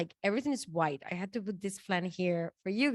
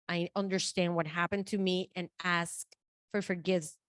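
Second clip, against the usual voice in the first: ordinary talk, nothing marked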